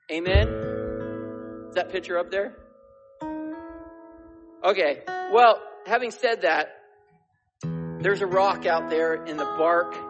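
loud call for amen; preaching loud. Acted. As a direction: belt it out from the pulpit.

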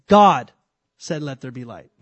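"God" punched very loud, then a pause; stress on GOD. Shown as a demonstration. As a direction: hit "God" hard, drop away on "said". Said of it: "put the emphasis on the wrong word".

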